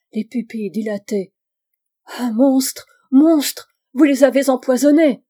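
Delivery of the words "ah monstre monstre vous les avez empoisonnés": much louder, higher outraged cry; horrified accusation. A narrator's reading. Girl accuses the professor of poisoning them, outraged shout. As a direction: hurl the accusation in horror.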